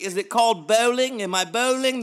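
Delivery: high, loud mock-British questioning; bad British accent. Prince asking fans about bowling, hammed-up British accent. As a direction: ask it in a cartoonish British voice. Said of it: "And that's my British".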